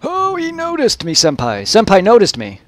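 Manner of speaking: high squealing fangirl voice; excited anime fan. Pretend. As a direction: gush like a starstruck anime fan.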